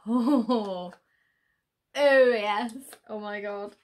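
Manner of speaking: loud, drawn-out delighted oohs; too excited. Real, not acted. Unboxer seeing the next item, far too excited. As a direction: belt out your delight at the find. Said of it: "Sorry, I'm just too excited".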